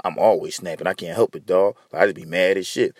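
low, subdued imitation of an excuse; mock excuse-maker. Excuse voiced, then rebuked.

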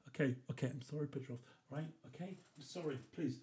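a hushed hammed-up apology to an imaginary Russian captor; mock scared plea. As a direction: pretend to plead with a captor, barely audible. Pleading with imaginary Petrov.

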